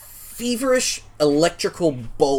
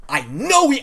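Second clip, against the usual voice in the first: pointed threat; 7 semitones higher, 3 dB louder than usual. Acted. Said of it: "just pointedly".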